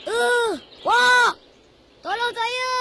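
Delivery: high, loud cry for help; yelling for help. Acted. Trapped boy screams to elder for help, yelling. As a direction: yell out to be rescued. Dia menjerit.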